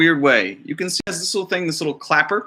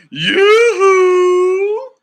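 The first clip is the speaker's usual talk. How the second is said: much higher, louder, slow calling yoo-hoo; sing-song yoo-hoo; 8 dB louder than usual. Acted. Grandmother interrupting the narrator with a loud sing-song call. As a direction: cry out like grandma waving from offstage.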